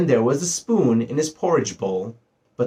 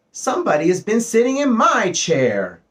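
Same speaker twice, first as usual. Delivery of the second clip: higher-pitched middle bear complaint; middle-sized bear voice; 10 semitones higher, 3 dB louder than usual. A narrator's reading. Middle-sized bear finds the cushion squashed, middle-sized voice. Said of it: "said the middle-sized bear in his middle-sized voice".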